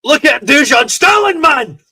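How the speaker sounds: excited shout. Real, not acted. Fan yelling at a player during the match, excited. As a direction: yell at the screen in delight.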